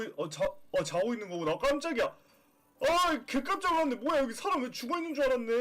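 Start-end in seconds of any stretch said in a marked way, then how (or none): 1.6-5.5 startled. Real, not acted. Recovering from scare.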